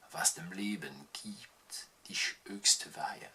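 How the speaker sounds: slow whispered verse; slow poetic whisper. Acted. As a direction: hush the words almost to nothing. Whispered verse in the German poem.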